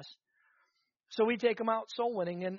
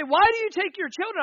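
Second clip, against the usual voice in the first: raised, challenging question from critics; loud quoted question; 11 semitones higher, 9 dB louder than usual. Retold or imitated. Preacher quoting critics loudly.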